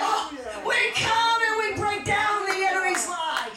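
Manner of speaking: high sustained battle cry, easing at the end; full-force preaching. Real, not acted. Preacher coming against the enemy's lie, full-force preaching. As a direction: cry it out as spiritual warfare.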